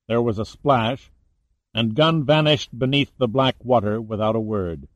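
ordinary delivery, nothing marked

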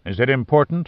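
ordinary delivery

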